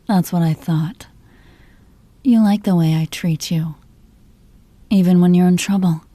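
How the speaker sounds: ordinary talk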